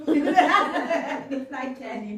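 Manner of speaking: real helpless amusement; laughing. Real, not acted. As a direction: crack up while saying it. Laughing at cough drop treat.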